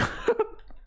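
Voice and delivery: short high giggle; amused laugh. Real, not acted. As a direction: giggle quietly in disbelief. Amused at another character's antics.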